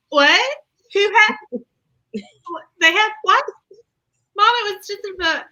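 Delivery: stunned by the story; incredulous. Real, not acted. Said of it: "Like, I".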